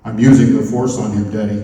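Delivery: low, loud voice of a kid playing Vader; imitating son using the Force. Young son playing the Force on a stranger.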